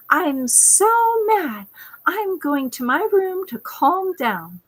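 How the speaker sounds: put-on high, slow, drawn-out child voice; mock pouty girl declaring; mad little daughter. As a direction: play the huffy kid stomping off. Daughter announcing she's mad.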